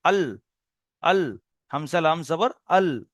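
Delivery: slow আল with the tongue tip on the teeth; slow letter demo. Shown as a demonstration. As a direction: say আল slowly, tongue edge on the teeth. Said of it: "এই কিনারা হলে এপারে". (ধীরে আল, জিভের কিনারা দাঁতে লাগিয়ে)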